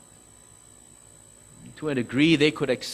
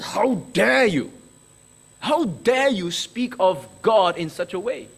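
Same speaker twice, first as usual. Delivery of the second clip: imitated outraged crowd, louder and higher; angry outburst; 4 semitones higher, 5 dB louder than usual. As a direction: hurl the accusation back like an offended mob. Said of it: "Oh, they got so angry".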